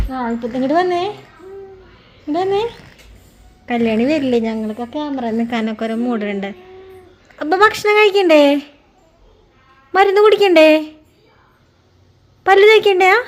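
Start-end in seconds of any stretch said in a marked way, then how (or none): 0.1-2.7 soft coaxing call. Real, not acted. Mother calling child softly. 7.4-13.1 bright, lifted questions, each a gentle nudge; high coaxing questions. Real, not acted. Mother coaxing her toddler to eat and brush, high sing-song questions.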